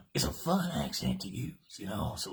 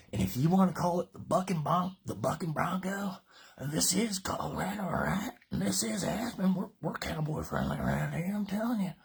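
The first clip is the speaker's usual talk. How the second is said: high, rallying workout hype; lively, high-pitched enthusiasm; excited hype; 7 semitones higher, 3 dB louder than usual. Real, not acted. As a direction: pitch it up like a cowboy rallying folks. Hyping the cowboy pose.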